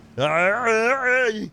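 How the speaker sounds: high wavering imitation call; put-on trill that climbs high; imitated creature noise. Shown as a demonstration. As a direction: do a silly warbling cry. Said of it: "I can do it".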